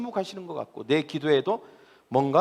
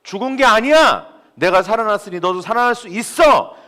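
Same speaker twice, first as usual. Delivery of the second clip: loud, high, forceful proclamation; crying out; 6 semitones higher, 8 dB louder than usual. Acted. Preacher voices the Lord crying out to the tomb, triumphant shout. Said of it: "무덤을 향한 외침이에요".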